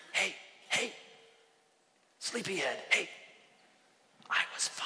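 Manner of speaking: imitating a hushed wake-up call; acted, breathy whisper to someone dozing; playful whisper. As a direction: whisper softly over a sleeper's shoulder. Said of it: "imagine God just whispering".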